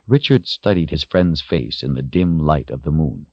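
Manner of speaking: usual speaking voice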